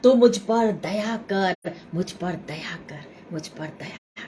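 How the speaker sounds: pleading fading to a whisper; soft plea dying away. Retold or imitated. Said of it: "और जोर से चिल्लाने".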